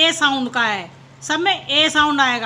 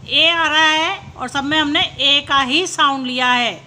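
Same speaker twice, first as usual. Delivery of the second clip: usual speaking voice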